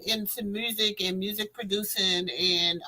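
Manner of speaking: ordinary talk, nothing marked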